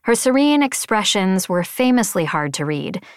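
usual speaking voice

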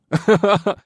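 loud high snickering laugh; drunken snicker. Acted. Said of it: "He snickered".

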